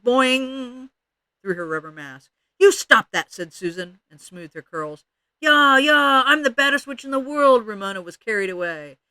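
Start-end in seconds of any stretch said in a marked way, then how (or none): 0.0-0.9 high, loud springy call; yelled boing. A narrator's reading. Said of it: "tweaked one of Susan's curls and yelled". 2.6-3.3 loud, high telling-off; indignant protest. A narrator's reading. Susan protests at hair being tweaked. 5.4-7.7 high, loud gleeful chant; yelled witch taunt. A narrator's reading. Ramona repeats her screamed witch taunt.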